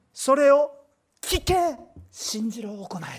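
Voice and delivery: loud, high, slow pulpit cry; fiery sermon crescendo; full-force preaching. Acted. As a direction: thunder it from the pulpit. Preaching crescendo on fear not.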